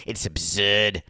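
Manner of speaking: badly faked Boston accent; Charles Emerson Winchester. Host repeating the character's word, bad Boston accent. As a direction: do a hammy, terrible Boston accent. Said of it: "That's the worst Boston accent ever".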